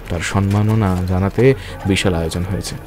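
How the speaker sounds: ordinary talk, nothing marked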